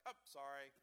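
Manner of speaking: breezy, offhand mock apology; careless sorry. Preacher mocking a careless worldly apology, offhand. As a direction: toss it off like it means nothing. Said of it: "it's just like".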